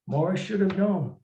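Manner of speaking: plain reading voice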